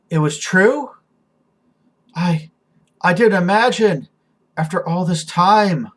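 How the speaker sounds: high, swelling amazement; overcome with wonder. Acted. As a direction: build from hushed disbelief to ringing rapture. Revived character overwhelmed by love.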